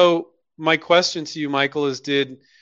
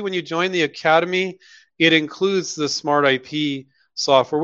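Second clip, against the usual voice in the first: ordinary delivery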